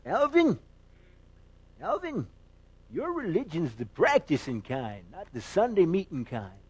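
put-on old country preacher calling out, then admonishing; Pastor Pyle. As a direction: impersonate a quavering movie pastor. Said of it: "he says".

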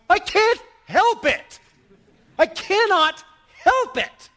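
high, emphatic preacher's cry. Real, not acted. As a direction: burst out as if you can't hold it in. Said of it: "why I raise my voice".

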